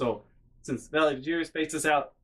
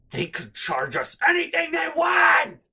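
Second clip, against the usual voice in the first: high, loud, stretched-out imitation shout; mom exploding; 5 dB louder, slower than usual. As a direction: blow up as the mother, screaming. Mom explodes about missing price.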